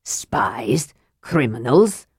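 low, hushed exasperated disbelief. A narrator's reading. Thane repeats his rival's accusations, exasperated. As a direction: echo the words in mild exasperation.

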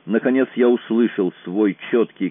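usual speaking voice

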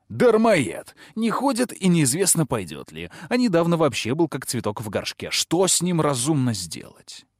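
a stern harsh voice. A narrator's reading. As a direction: bark it out to provoke. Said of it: "жёстким голосом сказал".

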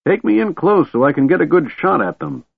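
put-on high-pitched old man's shout. A narrator's reading. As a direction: call out eagerly over the wind. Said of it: "the old man shouted".

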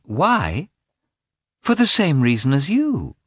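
high, miffed wife answering back; piqued and insistent; indignant retort. A narrator's reading.

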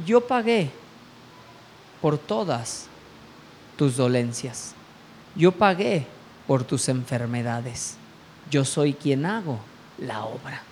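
low, soft, measured divine voice; God's voice. Retold or imitated. God speaking to the young man's heart, low and quiet. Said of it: "ahí es cuando Dios habla a mi corazón".

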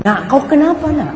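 high-pitched, pleading fatherly question; imitated concerned father. Father asking his crying daughter what is wrong, imitated concern. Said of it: "Nangis, kata si bapaknya".

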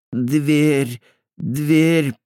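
mumbling through pliers in mouth; mumbled with mouth full. A narrator's reading. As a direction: mumble around something in the mouth. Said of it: "промычал Андеш".

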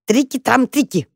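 high, playful mouse squeak; squeaked in ear. A narrator's reading. Mouse teases his brother's ear, squeaking. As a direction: squeak it mischievously. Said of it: "пискнул брату в самое ухо".

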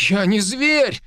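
high, loud pitiful protest; pitiful indignation. Acted. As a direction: cry out hurt and indignant. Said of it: "жалко возмутился Глицевич".

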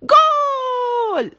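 long, high, loud drawn-out shout; shouting goal. A narrator's reading. Tarek cheering his kick with a long loud shout. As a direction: hold the cheer out like a football fan. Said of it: "وهو يصيح".

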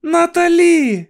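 high, loud, drawn-out cry of alarm; long high shout; shouted name. A narrator's reading. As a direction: scream the child's name slowly in warning. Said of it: "крикнула Луїза".

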